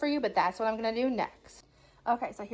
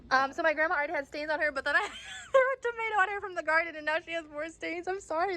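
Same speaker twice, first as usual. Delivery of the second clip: laughing confession; 5 semitones higher than usual. Real, not acted. Confessing she hit grandma.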